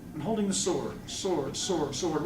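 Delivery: soft, trailing echo of a thought; musing to self. Acted. Lost child thinks aloud about the sword, musing softly. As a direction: repeat it quietly, puzzling it out.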